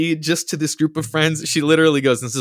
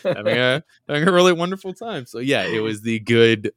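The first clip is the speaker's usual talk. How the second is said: ordinary delivery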